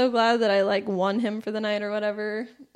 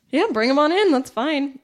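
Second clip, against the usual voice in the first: loud, higher-pitched retold go-ahead; eager; 5 semitones higher, 8 dB louder than usual. Retelling telling the roommate to bring him in, loudly eager. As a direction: burst out with an acted, over-ready yes. Acting out accepting the surprise delivery.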